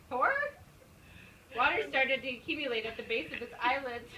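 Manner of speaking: ordinary delivery, nothing marked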